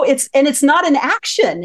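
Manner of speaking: usual speaking voice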